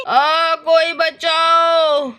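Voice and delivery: long drawn-out wailing shout; cry for help. Acted. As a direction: stretch out a hammed-up plea for rescue. घायल कबाड़ी मदद माँगता है. (दर्द में लंबी पुकार)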